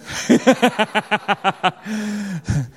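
a burst of high laughter; laughing at the parents in the room; loud high laugh. Real, not acted. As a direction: laugh out loud at your own joke. Laughing at own parent joke.

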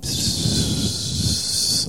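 unvoiced hiss to get attention; whispered psst. Strangers behind the bench whispering a hissed psst. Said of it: "I can hear them like".

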